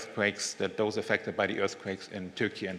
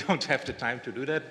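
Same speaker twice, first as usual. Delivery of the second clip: normal talking voice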